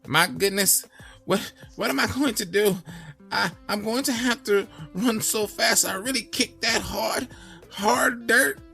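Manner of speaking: trembling donkey. Acted. Frightened Donkey talks to himself, high trembling voice. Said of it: "began to tremble".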